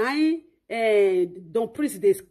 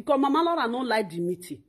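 normal talking voice

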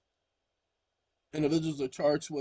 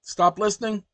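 command; 6 semitones higher, 7 dB louder than usual. Real, not acted. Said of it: "She's gonna start talking".

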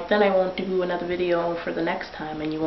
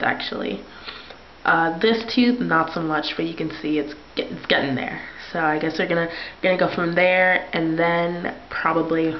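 talking with fingers holding lip; lip pulled down. Real, not acted. Seen 3: Finger, then both hands, hold lower lip down, showing braces.

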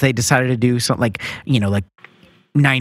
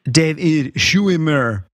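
slow, broken-up name in a mock Stargate accent; made-up Stargate accent, playfully demonstrated; much slower than usual. Shown as a demonstration. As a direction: draw the name out like Stargate alien language. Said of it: "in the Stargate accent".

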